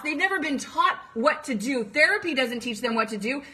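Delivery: normal talking voice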